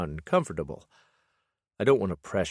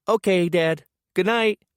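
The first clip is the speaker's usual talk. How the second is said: put-on high, loud little boy; child voice; 12 semitones higher, 7 dB louder than usual. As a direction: call it down like a happy kid. Son voiced, shouting from upstairs.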